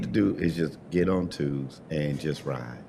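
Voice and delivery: low, smooth preacher voice; a hint of a sultry preacher. Real, not acted. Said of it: "he hit you with the real sultry voice".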